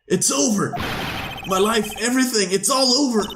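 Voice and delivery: high, wailing despair as Larry; a lovesick friend wailing that his life is over. Acted. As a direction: raise the pitch and wail like a ruined man. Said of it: "Oh, what voice?".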